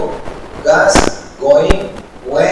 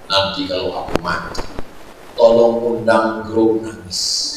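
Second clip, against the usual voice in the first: lightly voiced dying rich man; 9 semitones lower, 6 dB quieter than usual. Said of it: "Misalnya saya orang kaya".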